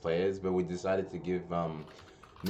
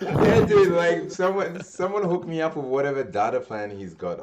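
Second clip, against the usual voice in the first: laughing; 9 semitones higher, 8 dB louder than usual. Real, not acted. Laughing at friend's data use.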